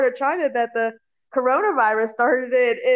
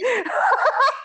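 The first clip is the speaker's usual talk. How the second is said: high laugh; 12 semitones higher than usual. Real, not acted. Laughing at own sensitivity.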